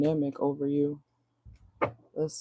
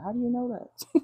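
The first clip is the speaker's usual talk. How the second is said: high, laughing "how do you know that"; mock surprise; 9 semitones higher than usual. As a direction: play caught-out shock with a laugh. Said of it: "this person's like".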